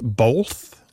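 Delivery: BOTH said with an L, like "bolth"; L sound inserted. Shown as a demonstration. Host asks caller about her pronunciation, BOTH with an L. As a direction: put an L before the TH in BOTH. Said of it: "with an L sound in it".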